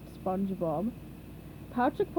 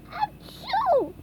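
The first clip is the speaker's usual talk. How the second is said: sneeze; 3 dB louder than usual. A narrator's reading. SpongeBob sneezes.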